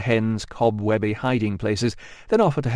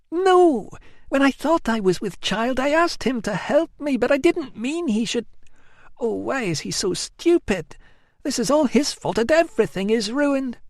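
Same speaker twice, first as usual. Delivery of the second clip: put-on high, plaintive young woman's wail; whiny Amelia; 14 semitones higher than usual. A narrator's reading. Amelia wailing to her cousin, high and plaintive. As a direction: wail it like a spoilt cousin begging pity.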